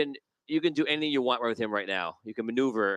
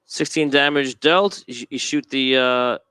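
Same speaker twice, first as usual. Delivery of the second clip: normal talking voice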